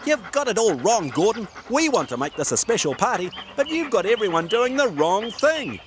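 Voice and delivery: high little puppy voice; young pup speaking up bravely; yapping pup. Acted. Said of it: "he yapped up at Gordon".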